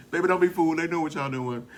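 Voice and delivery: loud warning. Real, not acted.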